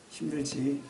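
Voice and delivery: very soft, low, caring question; tender voice of the Lord. Acted. The Lord comforting the weary, tender and hushed. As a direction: hush it down with sympathy. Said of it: "이렇게 말씀하실 거예요".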